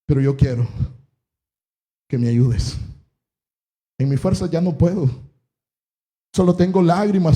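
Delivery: low, subdued confession with long pauses; heartfelt prayer. Acted. Struggling believer confessing to the Lord, subdued prayer. Leads a first-person prayer.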